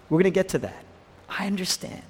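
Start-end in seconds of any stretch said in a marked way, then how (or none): 1.3-2.0 soft, higher-pitched, hushed compassion; gentle understanding. Acted. Jesus counseling Martha, gentle and hushed. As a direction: reassure very softly. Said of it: "Look at the gentleness of Jesus".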